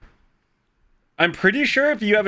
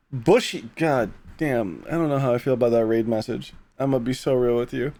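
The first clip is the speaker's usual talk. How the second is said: usual speaking voice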